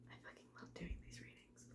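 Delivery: a fast, delighted hushed confession; whisper. Real, not acted. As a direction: sneak it out as a secret aside. Private aside during tarot reading.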